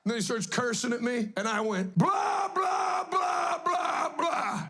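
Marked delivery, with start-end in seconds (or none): 2.0-4.7 drown him out with silly blahs; hammed-up Chris Farley babble. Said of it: "like Chris Farley in Dirty Work".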